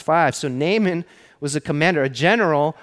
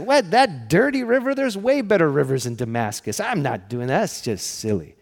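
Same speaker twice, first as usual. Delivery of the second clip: mock upset; 2 semitones higher than usual. Army commander balks at the dirty river, acted indignation. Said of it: "he actually gets upset".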